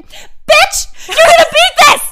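high shrieking yell across the street; retold yell. Woman yelling encouragement from across the street, high shriek. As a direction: scream it at your friends like a cheerleader. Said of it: "she's yelling at us".

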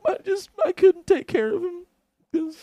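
high-pitched mock weeping voice; mock crying. Retelling the bar mom, high mock crying. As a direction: keep the fake sob going, high and wobbly. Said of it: "She was like".

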